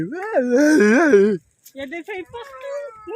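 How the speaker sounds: excited call. Real, not acted. Excited call while walking.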